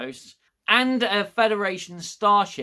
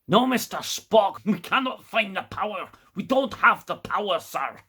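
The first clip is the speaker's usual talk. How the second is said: bad Scottish accent; 4 semitones higher than usual. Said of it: "his accent is really thick".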